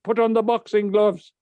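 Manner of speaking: high, loud teacher's command; acted shout from the priest; barked order. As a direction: imitate a fired-up boxing coach. Teacher orders boy to glove up.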